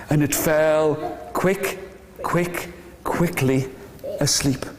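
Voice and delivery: slow, low, spaced-out narration; sleepy, lingering bedtime telling; drifting to sleep. A narrator's reading. Lulls duckling to sleep in narration.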